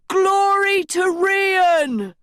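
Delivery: high ringing shout. A narrator's reading. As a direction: cry it out to the crowd. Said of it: "shouted another".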